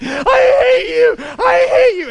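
screaming it high, loud and drawn out; furious child's tantrum. Acted. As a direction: act out a kid's shrieking rage. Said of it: "So I get so mad".

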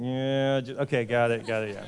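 low, soft, trailing embarrassment; sheepish admission. Acted. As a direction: admit it reluctantly, voice sinking low. Acted awkward admission to Pharaoh.

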